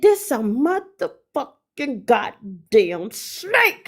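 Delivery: very slow, drawn-out cursing; stunned realization. Retold or imitated. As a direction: stretch every word as the horror dawns. Retelling the moment of realization.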